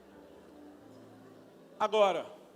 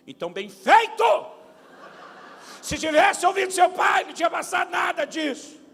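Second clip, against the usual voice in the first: scolding; 10 semitones higher, 11 dB louder than usual. Retold or imitated. Father scolding crying son.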